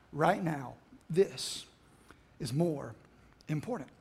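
soft, low, measured reassurance; gentle correction. Acted. Jesus speaking clarity to Martha, soft and calm.

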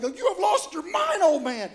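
high, loud disbelief; mock incredulous scolding. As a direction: tell him he's gone mad. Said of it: "He looks at me like".

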